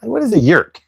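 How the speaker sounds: put-on a New York accent on "yerk"; New York accent mock.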